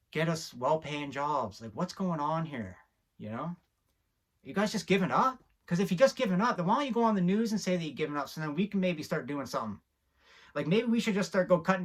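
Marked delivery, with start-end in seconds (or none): 4.4-7.5 higher, louder demand; exasperated challenge. Acted. Challenging the leaders, higher exasperated outrage. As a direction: throw the question at them.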